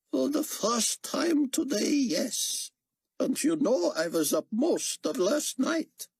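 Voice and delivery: put-on a weary old scholar speaking gently; elderly scholar voice. A narrator's reading. Chaim voiced by narrator.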